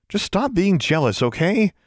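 high, coaxing plea; pleading. A narrator's reading. Girl to her jealous raven, pleading. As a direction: plead with a sulking pet. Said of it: "she pleaded".

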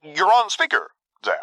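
high, strained, nervous voice; barely getting it out. A narrator's reading. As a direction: squeeze the words out nervously. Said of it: "Quinn barely managed to get out".

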